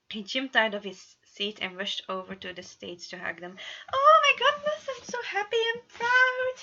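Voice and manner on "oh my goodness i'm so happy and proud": very high, loud, tearful joy; gushing. A narrator's reading. Patton hugs the couple, gushing high and teary. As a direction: gush with happy tears. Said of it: "he gushed".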